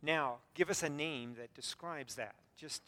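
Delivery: normal talking voice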